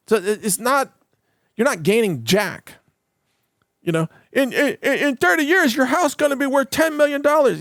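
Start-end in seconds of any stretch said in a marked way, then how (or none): 4.3-7.6 high, put-on mocking planner voice; mock financial planner. Host mimicking a planner promising house riches, high mocking voice. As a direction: push it up high to ridicule the promise.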